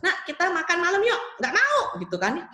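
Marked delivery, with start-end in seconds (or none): 0.0-1.4 higher-pitched coaxing parent; acted parent's invitation; parent calling child. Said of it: "contohnya gini". 1.4-2.0 put-on high, loud child's refusal; acted kid saying no; refusing child. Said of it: "contohnya gini".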